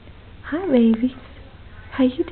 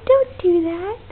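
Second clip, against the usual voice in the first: ordinary delivery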